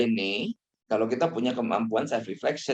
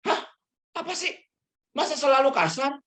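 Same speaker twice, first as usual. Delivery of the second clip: high, incredulous protest; husband denies being harsh, high and indignant; defensive denial; 13 semitones higher than usual. Acted. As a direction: scoff at the accusation. Husband denying, acted quote.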